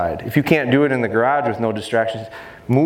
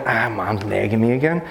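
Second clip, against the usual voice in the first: whiny dog voice. Retold or imitated. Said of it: "Recall wasn't".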